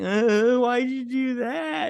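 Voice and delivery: high, drawn-out wail of protest; mock whiny victim. Man just punched on the show, imitated as a high wail. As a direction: whine it like someone just punched. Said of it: "he's like".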